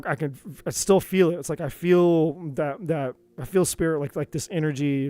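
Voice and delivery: ordinary talk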